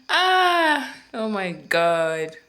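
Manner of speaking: overwhelmed with pride; loud happy gasp; proud excited exclaim. Real, not acted. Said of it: "So proud of myself".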